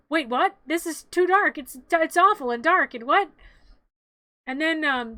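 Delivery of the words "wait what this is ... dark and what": higher, louder bewildered protest; incredulous imitation, raised pitch; mock dismay. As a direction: play Jack's baffled alarm, hammed up. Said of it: "And then Jack is like".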